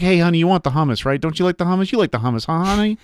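husband softly coaxing his wife to order the hummus; sweet-talking wife. Retold or imitated. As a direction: coax her gently into ordering it. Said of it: "I'm like".

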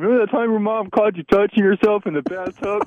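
high mocking imitation of family; high-pitched retold taunt; teasing relatives. Imitates family teasing him about it.

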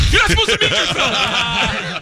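high, comic scolding through laughter; exasperated dad. Acted. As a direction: act out a panicked telling-off while cracking up.

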